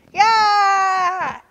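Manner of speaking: high, loud tantrum wails; screaming. A narrator's reading. Turtle throwing back her head for the bracelet, screaming. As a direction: scream it out, drawing the wail long. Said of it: "screamed at the top".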